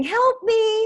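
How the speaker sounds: high pleading cry for help; mock plea. Host mimics stir-crazy people, high mock plea. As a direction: whine it like a desperate shut-in. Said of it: "they're saying".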